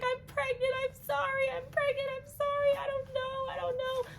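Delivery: high, rushed anxious babble; panicking, apologizing over and over; anxious panic. Retold or imitated. Newly pregnant woman, anxious and apologetic, in high panic. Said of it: "I was just like".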